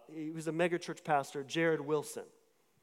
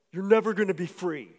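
louder, imposing retold voice; loud deep voice; 6 dB louder than usual. A voice jarring a sleeper awake, loud and deep. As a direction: imitate a loud, deep voice from nowhere. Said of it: "really loud, deep voice".

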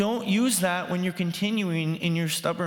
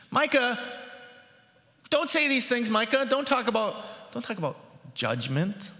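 people scolding Micah; 7 semitones higher than usual. Retold or imitated. Crowd hushing the prophet Micah, a high mock scolding. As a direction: scold the prophet, then let it drop. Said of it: "what the people are saying specifically is".

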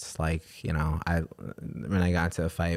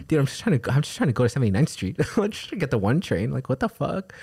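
higher, quicker, laughing through it; laughing exasperation; 7 semitones higher, faster than usual. Real, not acted. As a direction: laugh at the absurdity.

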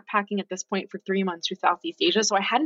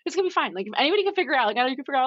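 reassuring friend; 10 semitones higher, much faster than usual. Retold or imitated. Friend reassuring her on the phone.

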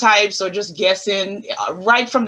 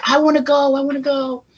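higher, eager little-sister plea; eager kid pleading; 6 semitones higher than usual. Retold or imitated. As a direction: beg to go along like a keen young girl. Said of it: "and I said".

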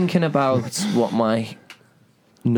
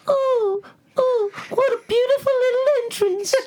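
very high, fluting posh British voice; a prim English gent gasping at the entrance, posh British; squeaky upper-class British exclamation; posh British accent. Acted. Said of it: "You gave my penis a British accent".

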